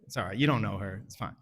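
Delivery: ordinary talk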